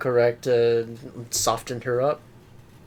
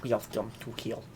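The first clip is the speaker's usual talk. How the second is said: quiet sinister; 10 dB quieter than usual. Acted. Repeats Skinner line, much softer.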